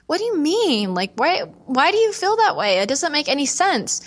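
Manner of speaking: a touch of confused concern; baffled mom pressing gently; puzzled mom. Retold or imitated.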